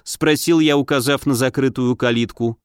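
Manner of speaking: plain reading voice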